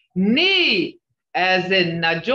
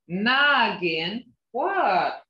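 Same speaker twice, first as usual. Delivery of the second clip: usual speaking voice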